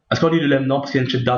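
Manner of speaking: ordinary talk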